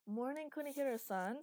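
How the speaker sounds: high, loud, chirpy greeting; brightly. Acted. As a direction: sing out a cheery hello. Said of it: "said Eli brightly".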